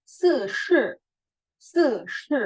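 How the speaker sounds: se with the chin forward, loud; se, se: jaw pushed forward; Mandarin se. Shown as a demonstration. Teacher showing mouth shape for se. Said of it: "kayak gitu".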